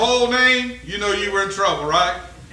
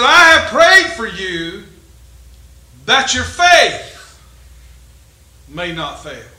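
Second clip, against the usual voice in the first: slow preaching; 6 dB louder, much slower than usual. Acted. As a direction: stretch each word, then let it fall. Slow scripture proclamation.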